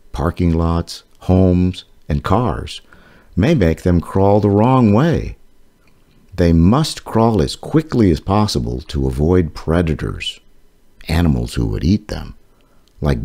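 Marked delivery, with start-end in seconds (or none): none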